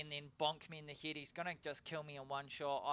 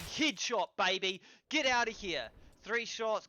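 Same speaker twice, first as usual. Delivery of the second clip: loud, hyped celebration after a headshot; real gamer hype, louder and higher; excited shout; 4 semitones higher, 7 dB louder than usual. Real, not acted. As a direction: yell it out like you just won.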